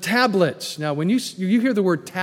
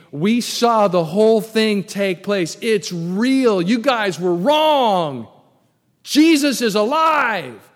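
excited guards; 4 semitones higher, 6 dB louder, slower than usual. Retold or imitated. Guards announcing resurrection to priests.

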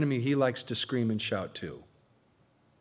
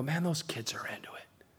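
hushed aside; whisper; 10 dB quieter than usual. Real, not acted. Aside about youth group.

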